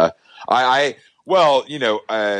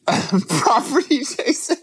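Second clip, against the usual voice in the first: laughing; 6 semitones higher, much slower than usual. Real, not acted. Repeats partner's intro mockingly.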